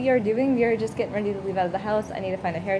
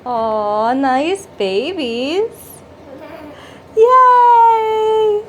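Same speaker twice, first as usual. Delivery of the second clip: excited cheer; 8 semitones higher, 9 dB louder, much slower than usual. Real, not acted. Mother cheering with her baby about the toys, excited high yay. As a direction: gush over the babies, then burst into a cheer.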